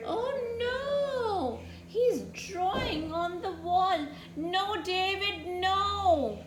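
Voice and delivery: put-on high-pitched, dramatic dismay for children; mock horror. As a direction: scold the little boy in exaggerated alarm. Storyteller scolds David for drawing.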